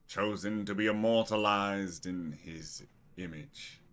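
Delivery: grand, drawn-out welcome with big opening words; smiling cult leader. Acted. Cult leader greets his chosen guest, grand smiling welcome. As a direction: proclaim it slowly, savoring the welcome. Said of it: "gets a smile on his face, and he goes".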